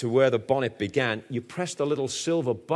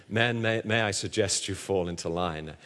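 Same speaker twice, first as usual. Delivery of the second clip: softer, meek polite Sergeant Wilson suggestion; gentle Sergeant Wilson; 5 semitones lower, 4 dB quieter than usual. Retold or imitated. Preacher imitating mild Sergeant Wilson to his men, softly. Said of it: "the gentleness of Sergeant Wilson in Dad's Army".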